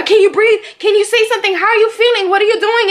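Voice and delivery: high, loud, urgent questioning; imitated high-pitched interrogation; barrage of questions. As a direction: fire questions like several people at once. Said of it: "ten different people asking me questions".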